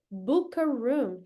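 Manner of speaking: "Book a room" said slowly, word by word; slow demo. Shown as a demonstration. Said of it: "O que que eu quero?".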